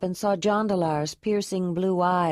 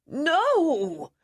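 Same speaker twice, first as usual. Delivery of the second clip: sharp high cry of denial; anguished cry; 10 semitones higher than usual. Acted. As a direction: cry it out, refusing the news. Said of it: "came a cry from the crowd".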